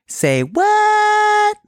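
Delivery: high, squeaky and loud disbelief; squeaky shocked yelp. Acted. Stunned Israelite reacts.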